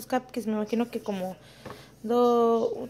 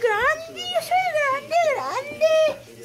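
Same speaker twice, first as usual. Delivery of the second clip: much higher, voicing the little boy's insistence; toddler voice; slower than usual. Mother voicing her toddler son to his dad, high childlike protest. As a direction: play the small son claiming he's big.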